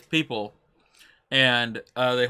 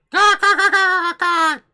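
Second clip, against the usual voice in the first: high shrill caws, much higher and louder; crow cawing; 6 dB louder, slower than usual. Retold or imitated. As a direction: caw like a screeching crow. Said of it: "I would have just been like".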